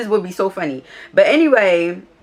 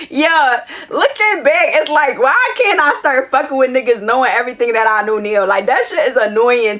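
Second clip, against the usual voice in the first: exasperated lament; 5 semitones higher, 4 dB louder than usual. Real, not acted. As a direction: wail it out in exasperated disbelief. Exasperated lament after cracking up.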